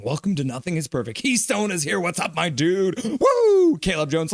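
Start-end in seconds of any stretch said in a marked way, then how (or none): 3.0-3.8 high, loud woo; high whoop. Real, not acted. Celebrating on stream, a loud high woo. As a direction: whoop with joy. Cheering chat support.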